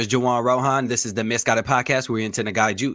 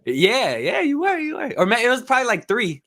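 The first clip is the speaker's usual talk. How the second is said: excited recognition; 12 semitones higher, 4 dB louder than usual. Real, not acted. Host remembers seeing guest before.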